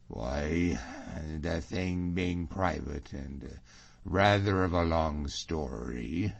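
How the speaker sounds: low, slow, hesitant reply; evasive James. Acted. James puts off Allan's question, low and hesitant. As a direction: stall smoothly, dropping the voice.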